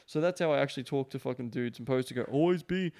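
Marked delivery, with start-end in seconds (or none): 2.2-2.9 slow, higher parody of a sales coach; mock sales slogan. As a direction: draw it out like a cheesy sales mantra.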